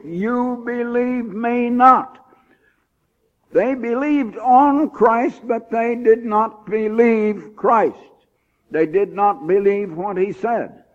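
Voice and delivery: high, loud, slowed pulpit preaching; preaching at full force. Real, not acted. Preacher pressing the church to believe and repent, in full-force preaching. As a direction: hammer each phrase home to the congregation. Preacher driving home the point.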